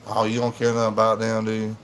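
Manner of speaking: ordinary talk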